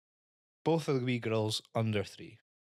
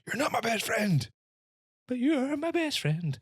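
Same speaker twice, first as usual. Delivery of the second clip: much higher, whispered mock child; the two girls fighting then making up, squeaky mock voice; squeaky child quarrel; 13 semitones higher, 4 dB louder than usual. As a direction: play two wee girls flipping from hate to love. Kids swapping friend and foe.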